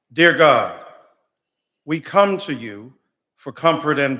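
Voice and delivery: public prayer. Real, not acted. Pastor praying before the state House, impassioned preaching prayer. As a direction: pray it from the pulpit, building fire against injustice.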